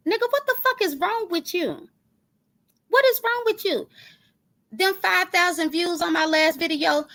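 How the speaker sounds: outraged disbelief. Real, not acted. Asking the rival what is wrong with him, outraged.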